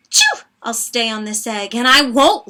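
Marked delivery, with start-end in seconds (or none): 0.1-0.4 high, loud sneezed chew; loud sneeze. Acted. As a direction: burst out with a big sneeze. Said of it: "said with a sneeze".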